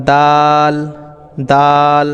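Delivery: দাল with the long vowel held one alif; drawn-out letter name. Shown as a demonstration. Teacher showing learners the letter দাল, long held vowel. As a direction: stretch the aa in দাল, held evenly. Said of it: "একটু টেনে এক আলিফ পরিমাণ".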